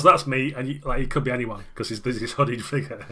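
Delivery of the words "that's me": put-on raised, louder old man; proud reveal as the old alderman. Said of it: "he goes".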